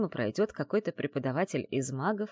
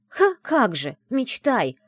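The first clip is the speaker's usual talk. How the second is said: high, loud, dismissive scoff; scornful snort; 9 semitones higher, 8 dB louder, slower than usual. A narrator's reading. Friend dismissing the heroine's suggestion, scornful snort. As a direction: scoff at a naive idea. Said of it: "фыркнула подруга".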